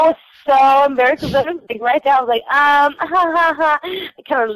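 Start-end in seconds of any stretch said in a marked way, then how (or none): 3.0-3.8 nervous giggle. Real, not acted.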